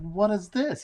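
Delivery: high, indignant mock outrage; imitating upset David. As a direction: act out the actor shocked by his script. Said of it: "David would get upset".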